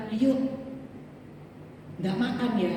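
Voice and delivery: understated, unpressing invitation; Javanese-style invitation. Retold or imitated. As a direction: imitate a Javanese host gently inviting. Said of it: "Kalau orang Jawa bilang".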